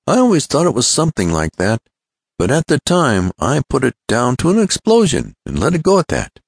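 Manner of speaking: lightly acted, lively afterthought; Ned. Ned reflects on his mishap.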